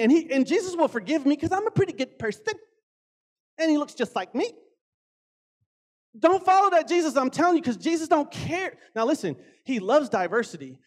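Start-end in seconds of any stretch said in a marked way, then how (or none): none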